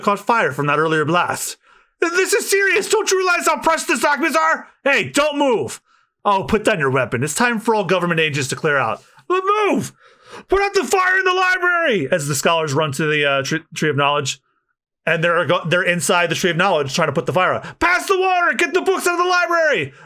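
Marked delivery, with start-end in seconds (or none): 2.0-12.1 high urgent cries; alarmed scholar voices calling out; frantic scholars. Acted. Scholars urging each other. 17.8-19.9 high shouted orders; urgent calls. Acted. Scholars fighting the fire.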